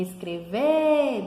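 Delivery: drawn-out calling of "escrever"; stressed syllable demo. Shown as a demonstration. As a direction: stretch the last syllable of "escrever". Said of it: "dá pra ver que é o".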